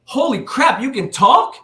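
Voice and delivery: very high, loud shocked outburst; astonished exclamation. Acted. Man amazed that a ball python can talk, astonished yell. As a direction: blurt it in amazement, voice shooting up.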